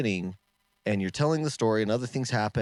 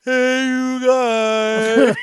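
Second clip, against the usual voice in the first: long, drawn-out high scream; very high, loud stretched holler; screamed heckle; 16 dB louder, much slower than usual. Retold or imitated. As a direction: imitate an audience member yelling across the room. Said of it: "screams out".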